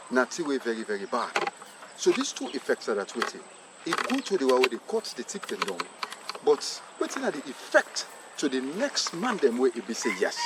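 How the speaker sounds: usual speaking voice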